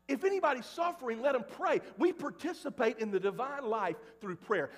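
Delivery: ordinary talk, nothing marked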